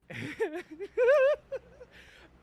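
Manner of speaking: high, loud burst of laughter. Real, not acted. Cracking up while telling the story, loud laughing burst. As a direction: cracks up at his own story.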